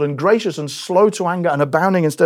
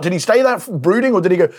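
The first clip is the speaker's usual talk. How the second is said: ordinary talk, nothing marked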